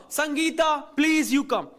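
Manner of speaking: ordinary delivery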